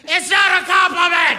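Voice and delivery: high, loud yell; furious high shout; dad yelling. Retold or imitated. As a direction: blow up at the son. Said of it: "As my dad once said".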